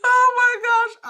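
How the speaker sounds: very high, loud squeal of laughter; crying with laughter. Real, not acted. Coach cracking up at a viewer comment, high laughing squeal. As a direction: laugh till the tears come. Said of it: "I'm crying".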